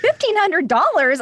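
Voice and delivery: excited. Retold or imitated. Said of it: "I was like".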